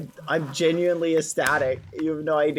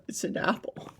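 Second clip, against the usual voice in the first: whisper; 9 dB quieter than usual. Acted. Aside answer after question.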